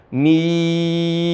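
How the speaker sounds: MIM held long on one level note; six-count mim. Shown as a demonstration. Teacher showing six counts, long-held "mim". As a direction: stretch the "ii" of "mim", close on m. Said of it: "Enam harokat".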